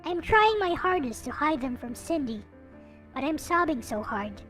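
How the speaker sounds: ordinary talk, nothing marked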